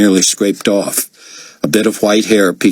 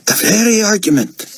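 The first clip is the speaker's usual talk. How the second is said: Albi's voice. Acted. Albi agrees, much higher.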